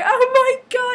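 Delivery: high, loud retold outcry; mock-dramatic exclamation. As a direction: exclaim it way up high, hamming up disbelief. Friends react to being caught.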